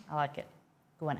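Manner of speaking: ordinary talk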